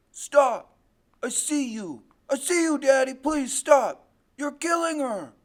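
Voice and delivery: put-on high, loud, frantic child's plea; desperate shouting. Boy screams at his father to stop choking her, desperate shout. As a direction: cry out in panic to make him stop.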